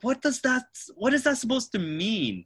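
high, loud incredulous comeback; acted-out affronted questioning; indignant disbelief. Retelling reaction to backhanded compliment.

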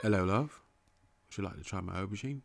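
low, soft, quick British come-on; British accent. Acted. Wooing a love with aubergine, soft British accent. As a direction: croon it quietly in mock-British. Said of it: "an accent that's not an American accent".

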